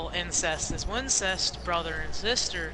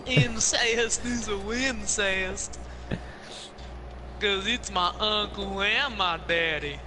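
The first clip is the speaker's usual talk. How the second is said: slow put-on Southern twang; mock southern drawl; slower than usual. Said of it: "that little twang in my voice".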